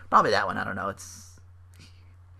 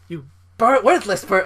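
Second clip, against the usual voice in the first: louder, higher mock tirade, stumbling short of the insult; imitated anger; 5 semitones higher, 6 dB louder than usual. Podcaster imitating a wrestler berating a woman, angry. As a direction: act out a mad wrestler holding back a curse. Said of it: "he got, like, really mad".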